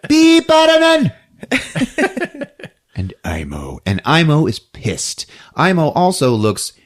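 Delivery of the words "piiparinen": Piiparinen called out high and loud; Finnish name. Shown as a demonstration. Showing off a Finnish name to the others, loud high call. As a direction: savor Piiparinen with a big flourish. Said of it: "You like Finnish name?".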